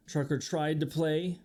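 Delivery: usual speaking voice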